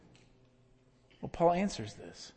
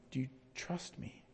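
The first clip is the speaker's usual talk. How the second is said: much softer, gentle asking; God asking everyone, much softer; soft God question; 15 dB quieter than usual. Acted. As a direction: ask it softly, barely voiced.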